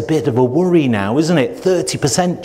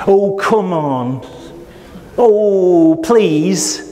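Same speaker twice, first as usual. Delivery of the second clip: exasperated; 4 semitones higher, much slower than usual. Shown as a demonstration. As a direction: roll your eyes at the supplier. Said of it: "you could say".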